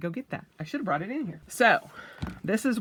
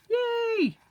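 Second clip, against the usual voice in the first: a squeaky, much higher little yay; bright sing-song yay of joy; excited cheer. Real, not acted. Cheering over her new notebooks.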